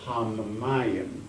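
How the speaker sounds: ordinary talk, nothing marked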